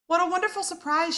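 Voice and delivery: fast delighted gush; delighted surprise. Acted. Mama waking surprised.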